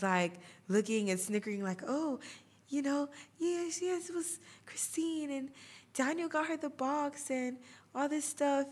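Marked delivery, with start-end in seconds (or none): 1.9-8.7 high, mocking singsong of teasing kids; mock the gossiping classmates up high. Classmates teasing her about Daniel.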